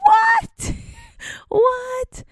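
stunned mom's squealed, laughing what; shocked what. Real, not acted. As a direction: shriek it in laughing disbelief. Mother shocked by daughter's excuse.

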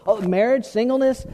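ordinary talk, nothing marked

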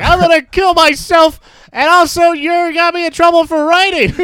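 high, loud hammed-up wail; mock hysterical outburst. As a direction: play the melodramatic kid. Mocking the girl's outburst.

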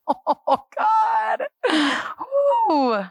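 high, drawn-out cry to God; overcome exclamation. Real, not acted. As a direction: let it soar up in awe. Overcome after telling of testimony.

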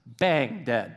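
ordinary talk, nothing marked

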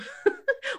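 burst of laughter. Real, not acted. Recalling the pillowcase she stole, real laughter. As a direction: crack up at the memory.

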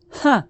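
loud, high mocking "Ha!"; scornful laugh. A narrator's reading. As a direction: laugh right in his face. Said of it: "laughed in his face".

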